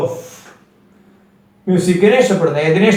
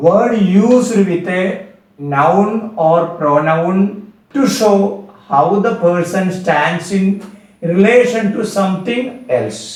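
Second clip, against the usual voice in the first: slow dictation; much slower than usual. Shown as a demonstration. Teacher dictates definition slowly.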